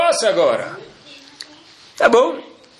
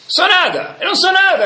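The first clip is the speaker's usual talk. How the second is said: high, pleading cry of nothingness; mock weeping plea, raised high; imitating tearful prayer; 12 semitones higher than usual. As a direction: wail it like a man broken in prayer.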